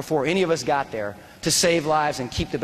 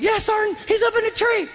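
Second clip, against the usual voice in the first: yelling. Retold or imitated. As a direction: yell it across the street over gunfire. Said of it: "Floyd's like".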